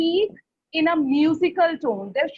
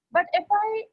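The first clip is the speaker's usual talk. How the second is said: ordinary delivery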